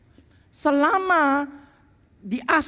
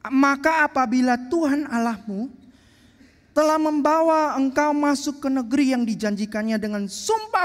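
measured, raised reading voice from the Bible; scripture reading; 3 semitones higher than usual. Acted. Preacher reading Deuteronomy to the congregation, solemn and raised. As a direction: read the verses out to the congregation with weight.

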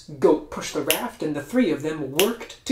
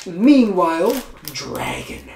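ordinary delivery

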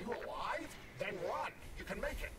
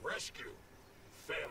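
robot voice; 4 semitones lower than usual. Acted. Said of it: "You sound like a robot".

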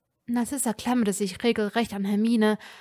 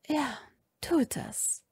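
softer, breathy near-whisper; quiet; 5 semitones higher, 6 dB quieter, slower than usual. A narrator's reading. Mother answering her husband, softly. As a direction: barely voice the words. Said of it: "sagte Narzissa leise".

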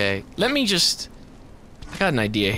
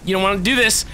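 firm warning at the usual pitch; taunting warning; much faster than usual. Real, not acted. Gamer warning enemies off, firm taunt. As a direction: warn them off without screaming yet.

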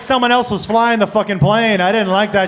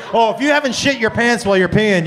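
ordinary talk

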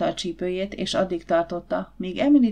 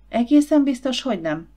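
disappointed question, first words pitched higher and louder; 5 semitones higher, 5 dB louder than usual. A narrator's reading. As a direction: let the disappointment spill out in a sudden high, loud start. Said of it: "kérdezte Emily csalódottan".

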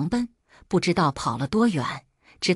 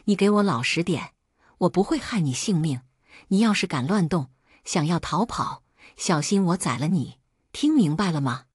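plain reading voice

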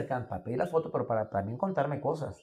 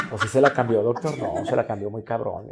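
low, laughing mockery; a hint of teasing passengers; 5 semitones lower, 3 dB louder than usual. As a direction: tease him through a chuckle. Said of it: "La gente". (pasajeros burlones, grave y entre risas)

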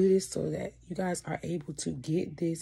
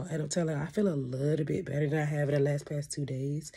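ordinary delivery

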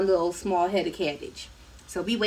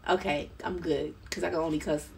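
usual speaking voice

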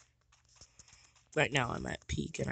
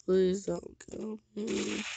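high, loud frantic begging; panicked pleading; 11 dB louder, much slower than usual. Real, not acted. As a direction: plead for your life, slowing at the end. Being shot, pleading in panic.

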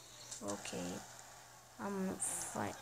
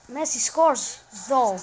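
excited call; 3 semitones higher, 12 dB louder than usual. Real, not acted. Gamer cheering his striker's goal, loud excitement.

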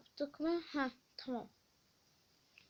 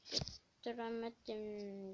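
Götüremedim stretched slowly, syllable by syllable; slow, sulky drawn-out Götüremedim; 4 semitones lower, 6 dB quieter, slower than usual. Shown as a demonstration. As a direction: drag out Götüremedim in frustration.

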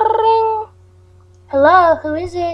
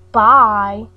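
normal talking voice

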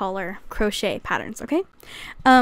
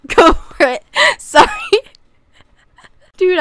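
louder laughing fit, higher, near tears; helpless laughter; 7 semitones higher, 7 dB louder than usual. Real, not acted. To viewers over a lizard pineapple hat, crying with laughter. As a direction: lose it completely, laughing till you cry. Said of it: "I'm literally gonna start crying".

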